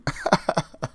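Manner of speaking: real laughter. Real, not acted. Laughs after prank reveal.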